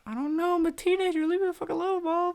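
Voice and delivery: very high squeaky mock-teen outburst; hammed-up falsetto teen tantrum; whiny teenager. Acted teenage outburst at his mom.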